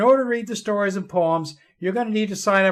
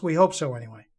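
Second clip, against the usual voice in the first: ordinary delivery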